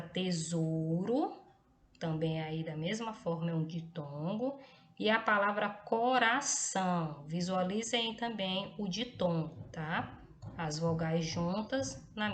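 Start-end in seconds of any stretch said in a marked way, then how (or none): none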